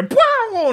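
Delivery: very high, loud "bow" noise; imitated catapult launch. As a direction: make a flung-through-the-air whoosh yell. Imitates men flung by catapults.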